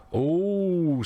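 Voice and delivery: long, rising-and-falling excited "oh". Acted. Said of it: "sounding excited".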